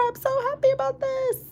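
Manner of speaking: gushing high squeal; squealing delight. Real, not acted. Gushing over the cute rings.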